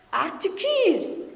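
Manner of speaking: high, over-articulated taunt; mocking classmate. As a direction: spell out the order like a teasing boy. Pancarte du garçon moqueur. (camarade moqueur, aigu et appuyé)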